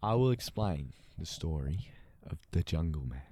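very low-pitched narrator voice; mock storyteller. Friend introducing a story for immersion, deep mock narrator. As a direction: announce the tale in a put-on deep voice.

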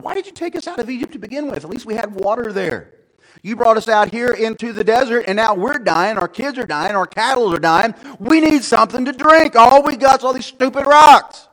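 high-pitched complaint building to a shout; plead, then yell the grievance; crying out. A narrator's reading. Thirsty people blaming Moses in the desert, crying out. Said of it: "they cried out".

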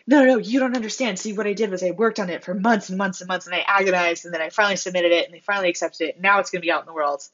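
mock eager bragger who won't take a hint; insistent over-explaining.

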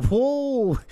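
very high, loud yelp; laughing shock, shoot the pitch way up; real startled outburst; startled whoa. Real, not acted. Startled by friend's insult.

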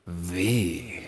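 low, soft, lingering "V"; mock-romantic low murmur. Shown as a demonstration. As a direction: breathe out "V" like a sweet nothing. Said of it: "solo que más romántica".

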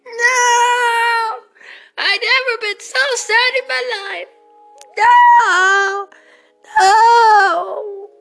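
high, loud melodramatic lament; melodramatic grief. Acted. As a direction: ham up the heartbreak. Grieving the gum.

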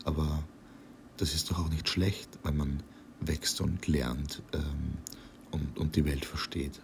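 very soft, slow and hushed; barely above a breath, faltering. A narrator's reading. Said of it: "Und noch leiser".

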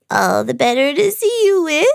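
high, squeaky put-on granny; fake grandmother. Disguised villain creeps closer to Minnie, high funny voice.

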